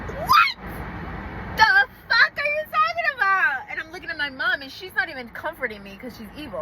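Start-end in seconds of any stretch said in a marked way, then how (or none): 0.1-3.6 shocked shriek. Retold or imitated. Shocked reaction to paternity news.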